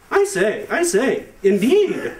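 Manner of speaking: high, hammed-up upper-crust voice; posh Ivy Leaguer. Ivy League killer mid-stabbing, a hammed-up posh exclamation. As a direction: play a snooty preppy toff. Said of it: "he was probably like".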